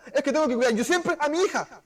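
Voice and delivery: excusa aguda y burlona; esposa que se excusa. Retold or imitated. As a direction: imitando a la esposa. (imitación aguda de esposa excusándose)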